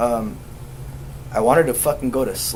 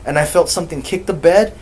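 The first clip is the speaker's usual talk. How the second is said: loud, higher, agitated explaining; imitating a worked-up nephew, voice raised; rattled nephew; 4 semitones higher, 6 dB louder than usual.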